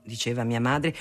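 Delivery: usual speaking voice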